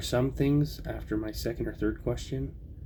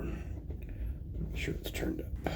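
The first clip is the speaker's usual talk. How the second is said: unvoiced whispered aside; whispering; 5 dB quieter, much slower than usual. Real, not acted. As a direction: whisper it under your breath. Quiet aside while starting playback.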